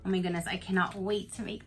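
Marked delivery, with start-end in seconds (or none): none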